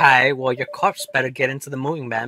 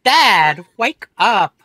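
put-on higher, louder teen calling dad; teenage daughter voice; 7 semitones higher, 9 dB louder, much slower than usual. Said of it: "I don't like this voice I gave her".

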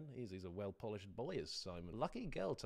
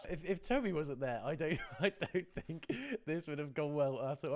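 laughing; 5 semitones higher, 5 dB louder than usual. Real, not acted. Reacts laughing to his own performance.

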